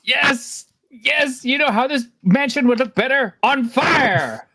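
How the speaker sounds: high, delighted cheering; thrilled by the idea of fire; gleeful excitement. Real, not acted. Player gleeful about burning mansion.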